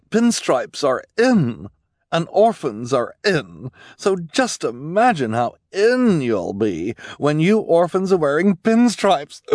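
gushing, high and loud fashion devotee; Esme. Acted. Esme raving to orphans about pinstripes, gushing.

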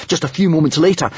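ordinary delivery

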